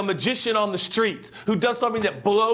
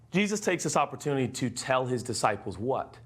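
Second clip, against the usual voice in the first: usual speaking voice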